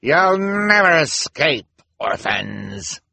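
high, loud taunt dropping at the end; villainous shout. A narrator's reading. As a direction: stretch out the threat as you shout after them. Villain calls out while chasing children.

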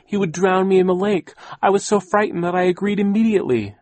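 high, loud, plaintive excuse; whining. A narrator's reading. Frightened aunt explaining the forced will, whining. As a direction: keep the pitch up and plead your case. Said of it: "Aunt Josephine whined".

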